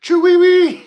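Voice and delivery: very high, loud, drawn-out squeal; stretched, piercing falsetto catchphrase; silly squealed call. Pretend. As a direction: shriek the nonsense word like a cartoon bird.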